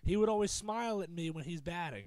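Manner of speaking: ordinary talk, nothing marked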